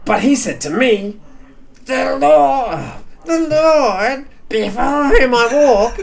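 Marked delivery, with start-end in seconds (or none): 1.8-5.9 very high, louder whining; whingey voice. Acted. Henchman quoting Abraham in a very high whingey voice. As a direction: whine high and slow. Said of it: "henchman doing a whingey voice".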